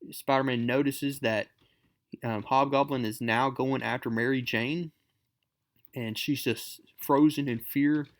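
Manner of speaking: ordinary delivery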